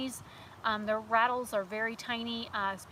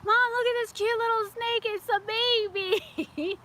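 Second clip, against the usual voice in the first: put-on excited kid; 10 semitones higher, 5 dB louder than usual. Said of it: "I'm like".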